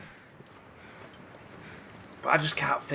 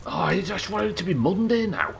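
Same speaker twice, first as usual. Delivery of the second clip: whiny wish; 10 semitones higher than usual. Real, not acted. Exhausted, wishing it over.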